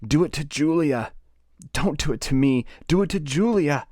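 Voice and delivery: high-pitched desperate outcry, acted; crying and screaming. A narrator's reading. Winston screams at O'Brien under torture, crying and screaming. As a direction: cry out in panic, high and pleading, short of a full scream. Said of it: "Winston begins crying and screaming".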